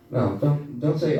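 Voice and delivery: ordinary talk, nothing marked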